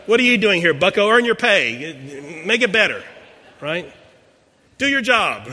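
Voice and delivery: loud, mocking rebuke with high pitch; mother mocking the pastor's uselessness; sarcastic scolding. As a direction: scold him to earn his pay. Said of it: "Kinda like".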